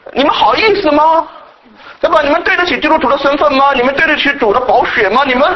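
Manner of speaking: put-on guilt-trip the flock like an old preacher; mock scolding preacher. (模仿训斥人的老派讲道)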